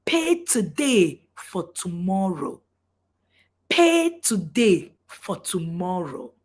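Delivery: slow, weighty, word by word; slow emphasis. Real, not acted. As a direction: drive the slogan home slowly. Slogan driven home to audience.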